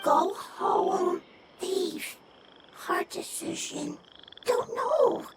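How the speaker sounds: soft, slow, halting creature words; little creature struggling for words; small creature voice. Acted. Small creature speaking broken words.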